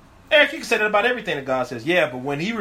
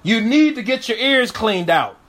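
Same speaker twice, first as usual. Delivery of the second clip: "Look" called out loud, then the "you need to" lines raised and pushed; voicing Christ with loud, higher urgency; urgent warning; 5 semitones higher, 4 dB louder than usual. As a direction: press each "need" hard like a stern warning. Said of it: "got him to where he said".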